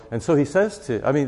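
ordinary delivery

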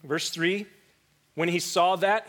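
usual speaking voice

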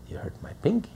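high, soft mocking whimper; mock a petty complaint; mock whine. Mocking people upset over a small hurt, high soft whine.

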